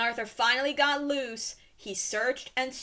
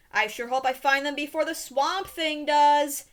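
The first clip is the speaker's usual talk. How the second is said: raised voice, pitched up to be overheard; said loudly; 5 semitones higher, 6 dB louder than usual. A narrator's reading. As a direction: call it out so the hiders hear. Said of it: "he added loudly".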